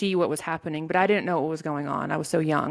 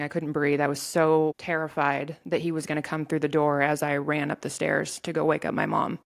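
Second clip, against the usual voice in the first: ordinary talk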